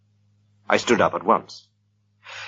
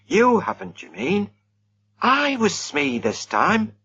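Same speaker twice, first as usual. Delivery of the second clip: lightly voiced youth, higher; young man's voice; 13 semitones higher, slower than usual. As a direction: play the impatient young host. Reggie correcting the narrator.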